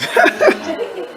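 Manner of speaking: a high, quiet squeal of a laugh; high giggle. Real, not acted. Laugh after rudely interrupted joke.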